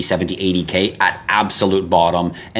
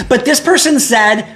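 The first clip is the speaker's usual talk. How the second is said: much higher, slow whiny voice mocking a follower; 4 dB louder than usual. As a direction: whine it like a pestering follower. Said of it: "comes to me and says".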